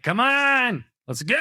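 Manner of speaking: high impatient urging. Retold or imitated. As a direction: hurry them along, fed up. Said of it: "I'll be sitting in traffic being like".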